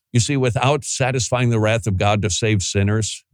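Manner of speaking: usual speaking voice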